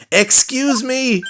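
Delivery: very high, drawn-out attention call; insistent call. Acted. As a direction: stretch it out slowly and high to get through. Louder retry at a quiet stranger.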